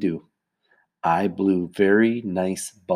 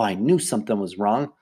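usual speaking voice

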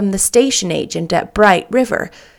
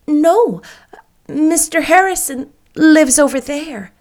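astonished; 7 semitones higher than usual. Acted. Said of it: "quite lost in astonishment".

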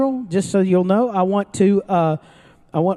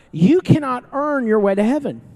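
normal talking voice

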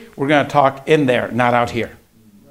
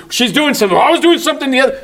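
high, loud, hurried excuses; mock argument piling up fast; bickering; 9 semitones higher, 5 dB louder, faster than usual. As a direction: imitate squabbling over chores. Voicing bickering over chores.

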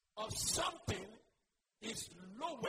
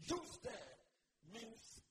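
normal talking voice

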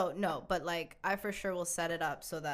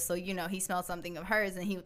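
normal talking voice

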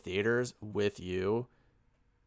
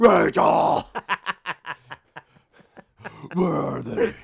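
loud, high hammed-up Batman cry; Batman shout; 4 semitones higher, 8 dB louder, much slower than usual. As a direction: bellow the name like Batman.

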